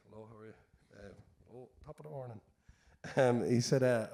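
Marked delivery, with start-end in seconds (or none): 0.1-2.4 barely audible pub regular's greeting; Irish bar drinker. Acted. Irishman who just likes drinking answers a billionaire, hushed and mild. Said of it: "the Irish guy's like".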